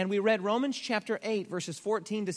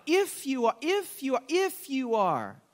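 higher, insinuating "If you are", repeated; doubting if; 4 semitones higher, slower than usual. Shown as a demonstration. Preacher mimicking the tempter's question, insinuating and doubtful. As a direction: plant the seed of doubt. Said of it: "platform of doubt".

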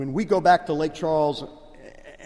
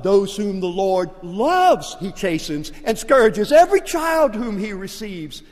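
preaching; 5 semitones higher, 9 dB louder than usual. Acted. Preacher quoting scripture to the congregation, in full cry. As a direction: proclaim it from the pulpit.

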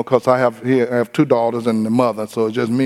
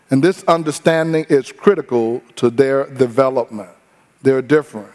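ordinary talk, nothing marked

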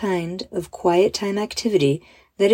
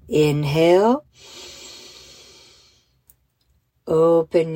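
slow, drawn-out breathing cues; slowly; slower than usual. Shown as a demonstration. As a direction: stretch each cue out with long pauses. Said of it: "but more slowly".

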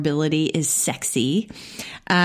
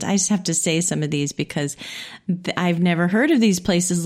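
ordinary talk, nothing marked

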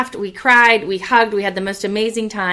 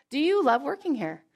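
ordinary delivery

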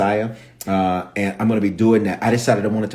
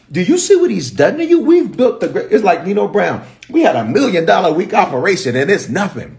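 high, loud mock outrage; outraged protest; 7 semitones higher, 4 dB louder than usual. Joab arguing with David over what he's done, outraged. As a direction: protest incredulously in a raised voice.

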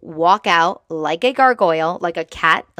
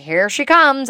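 calling out; 7 semitones higher, 6 dB louder than usual. Retold or imitated. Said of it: "they're like".

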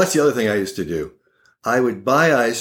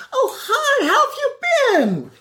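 put-on very high, laughing old-lady greeting; sweet old grandma. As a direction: greet him brightly like a polite stranger. Grandma greets him like a stranger.

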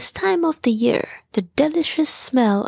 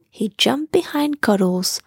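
usual speaking voice

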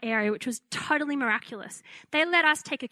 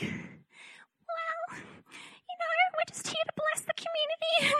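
put-on very high, soft childlike squeak; timid little voice; 5 dB quieter than usual. Young pastor answering a threatening drunk caller, timid childlike squeak. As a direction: sound like a scared five-year-old. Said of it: "I sounded like I was five".

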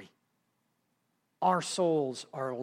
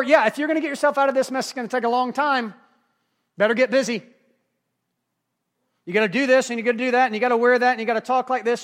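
higher, louder rattled-off list of demands; mock bossy advice; 7 semitones higher, 7 dB louder, faster than usual. Religious adviser telling the lost to get busy, mock bossy insistence. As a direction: pile up the obligations insistently, imitating an adviser.

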